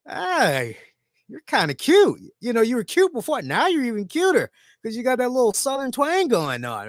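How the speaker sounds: high-pitched playful flirting; flirty reaction. Retold or imitated. Acting out his flirty reaction.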